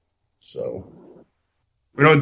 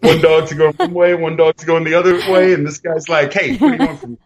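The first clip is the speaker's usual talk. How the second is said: laughing; 4 semitones higher, 4 dB louder than usual. Acted. Acting out cross-eyed mask joke.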